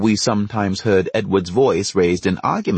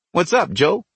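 put-on a light American accent, pitched higher, teasing; 9 semitones higher than usual. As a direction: Trinidad boy mocking with a higher American accent. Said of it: "would say in an American accent".

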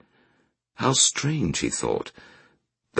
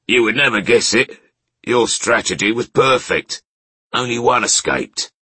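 outlaw leader; 9 semitones higher, 3 dB louder than usual. Acted.

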